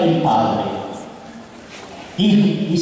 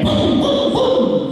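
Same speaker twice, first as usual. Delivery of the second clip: thumping heartbeat noise; much slower than usual. Retold or imitated. Baby leaping in the womb.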